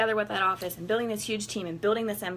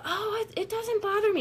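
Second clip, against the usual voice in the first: much higher, fake-sweet denial; mock nonchalance; 13 semitones higher than usual. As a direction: pretend it's all fine. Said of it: "Things that we're like".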